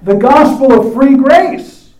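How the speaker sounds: loud, slow, ringing "grace"; stress on grace. Acted. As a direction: proclaim "The gospel of free grace" with weight. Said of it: "I love these next words".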